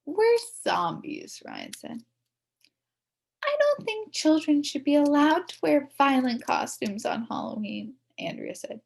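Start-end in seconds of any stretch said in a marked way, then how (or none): 0.0-1.4 proud answer. Acted. Boy announcing costume. 3.4-8.0 high, prim disapproval; a prim little girl scolding the boys; lightly voiced bossy girl; prissy know-it-all.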